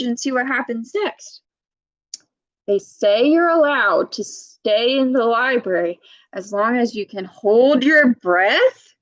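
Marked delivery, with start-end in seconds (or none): none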